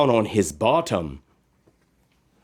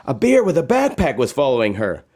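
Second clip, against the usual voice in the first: usual speaking voice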